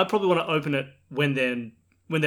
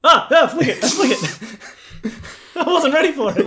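laughing, higher and louder, caught off guard; laughing panic; 8 semitones higher, 5 dB louder, slower than usual. Real, not acted. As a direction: blurt it out through startled laughter. Switch flicked before he's ready.

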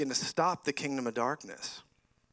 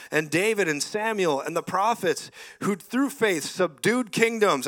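preaching scripture; 3 semitones higher than usual. Acted. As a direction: build the verse for the congregation.

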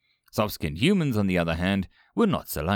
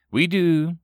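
louder, grudging reply; grumbling; 8 semitones higher, 5 dB louder than usual. Acted. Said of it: "Wu grumbled".

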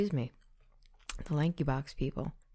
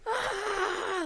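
high, strained imitation cry; mock YouTuber scream. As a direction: squeal like a clickbait thumbnail. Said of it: "They were always yelling and screaming".

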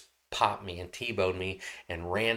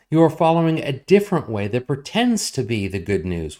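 loud, raised, emphatic proclamation; forceful scripture reading; 4 semitones higher, 8 dB louder than usual. Acted. Preacher reading Paul's rebuke to the Galatians, forceful. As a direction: declaim it to the whole room.

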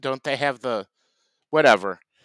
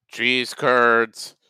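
ordinary talk, nothing marked

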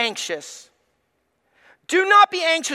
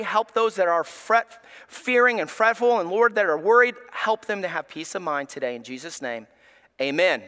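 prayer; 4 semitones lower than usual. Acted. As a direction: pray it humbly to the Father. Pastor leads a prayer.

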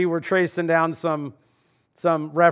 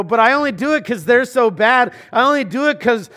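whiny excuses; 6 semitones higher, 5 dB louder than usual. Retold or imitated. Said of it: "we're tempted to go".

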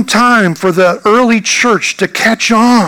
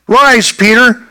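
raised, higher voice calling out a command; commanding heavenly voice; 5 semitones higher than usual. Acted. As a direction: proclaim it as a voice from heaven. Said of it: "there came a voice to him".

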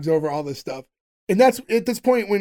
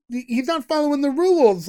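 put-on girl's whine; 7 semitones higher than usual. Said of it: "quoting the girl again".

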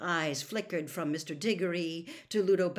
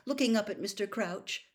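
plain reading voice

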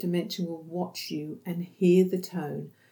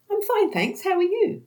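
much higher, light, chirpy reply; breezy small-talk answer; sing-song chit-chat reply; 14 semitones higher, 5 dB louder than usual. Shown as a demonstration.

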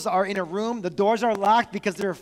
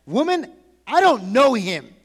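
forceful denial, louder; 4 semitones higher, 7 dB louder than usual. Acted. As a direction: push him away with the words. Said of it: "he said".

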